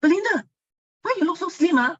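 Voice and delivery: high, loud amazed greeting; surprised colleague. Retold or imitated. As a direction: call out in surprise at her new shape. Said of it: "then she asked me".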